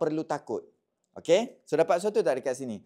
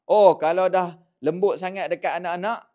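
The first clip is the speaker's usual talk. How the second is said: loud, higher imitation of objectors; hammed-up loud protest; mock outrage; 5 semitones higher, 9 dB louder than usual. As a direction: parody a triggered commenter, raised voice. Mocking quote of triggered commenters.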